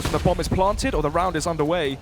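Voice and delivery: ordinary talk, nothing marked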